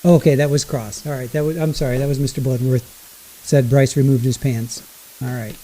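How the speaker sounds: ordinary delivery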